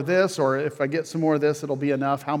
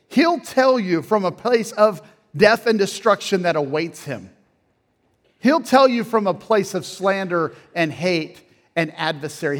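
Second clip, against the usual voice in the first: rising, louder preacher's build, higher and louder; impassioned preaching; 5 semitones higher, 6 dB louder than usual. Acted. Pastor urging listeners to bring pain to God, impassioned preaching. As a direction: press it home to the congregation, climbing in pitch.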